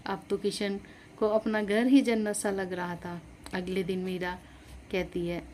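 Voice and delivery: ordinary delivery, nothing marked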